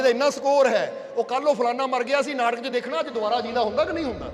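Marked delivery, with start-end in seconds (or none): none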